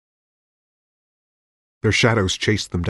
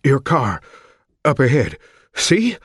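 panting; 6 semitones higher, 4 dB louder than usual. Acted. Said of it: "Elton panted".

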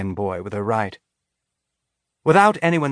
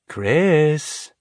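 drawn-out teasing sing-song; mocking sing-song; 6 semitones higher than usual. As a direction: sing the name to tease her. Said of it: "with a mocking sing-song voice".

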